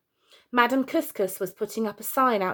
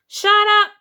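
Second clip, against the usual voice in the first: sudden, much higher, very loud shout; angry shout; 13 semitones higher, 11 dB louder than usual. Acted. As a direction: bellow it out at the neighbours' kids. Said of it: "he heard".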